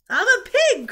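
put-on high, very loud boyish shout; show-off kid yelling; gleeful cry. A narrator's reading. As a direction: cry out like a rowdy boy. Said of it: "cried Avery".

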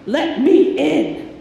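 calling at the door like the big bad wolf. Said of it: "came and said".

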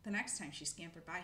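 usual speaking voice

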